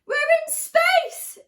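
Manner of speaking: high, loud, slow excited yell. Acted. Danny shouting to the dinosaur, thrilled and loud. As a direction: shout it with wonder. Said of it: "Danny yelled".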